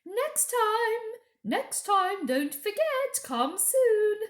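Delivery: high, loud call after a departing sledge; loud, high parting call. Acted. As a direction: call out across the snow. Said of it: "calling out".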